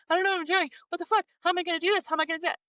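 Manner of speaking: high, frantic panic; panicked. Retold or imitated. Texting her friend, a high frantic panic. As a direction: rattle off the worries in a high panic. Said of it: "I text you and I'm like".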